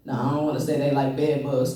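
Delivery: usual speaking voice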